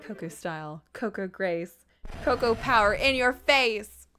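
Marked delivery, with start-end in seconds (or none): none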